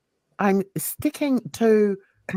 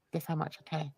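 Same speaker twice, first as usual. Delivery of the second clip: much softer, lower dismissive question; dismissive aside; 5 semitones lower, 13 dB quieter, much faster than usual. Real, not acted. Telling critic how little they matter, quiet dismissive aside. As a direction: drop it almost under the breath.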